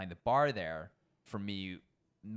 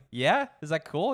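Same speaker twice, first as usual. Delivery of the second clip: nervous check; 8 semitones higher, 10 dB louder than usual. Retold or imitated. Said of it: "I was like".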